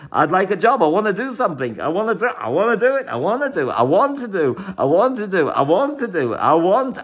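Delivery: high-pitched, insistent chant of desire; acting out urgent, repeated demands; forceful wanting. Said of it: "It's a forceful energy".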